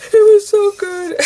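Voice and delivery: high, loud, tearful gush, voice breaking; choked-up crying. Retold or imitated. Mom reenacting tearfully praising the actor after the play, crying. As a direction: sob out the praise, overcome. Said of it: "I couldn't even talk to him".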